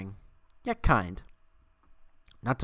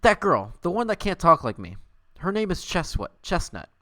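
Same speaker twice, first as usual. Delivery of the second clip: lightly voiced girl squirrel, higher; a hint of a chipper female squirrel; Etwa explaining; 6 semitones higher, 4 dB louder than usual. Streamer reading Etwa's line to Scrat, lightly higher. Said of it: "Etwa explained".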